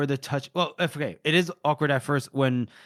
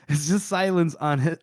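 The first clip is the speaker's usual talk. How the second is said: ordinary talk, nothing marked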